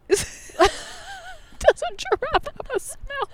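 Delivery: very high, slow and laughing; laughing question. Real, not acted. Amused by the giraffe smell, laughing high and slow. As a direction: ask it while cracking up.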